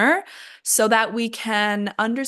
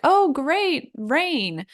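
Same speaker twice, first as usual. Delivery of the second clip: high-pitched delight, dropping at the end; sincerely pleased; 7 semitones higher, much slower than usual. Shown as a demonstration. Someone actually happy to see rain, delighted. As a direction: exclaim it as if truly glad. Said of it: "They're not actually saying, like".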